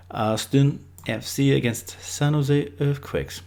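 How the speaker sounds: slow, put-on English accent. Reading the next football fixture in a put-on slow English accent. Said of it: "doing them in an English accent".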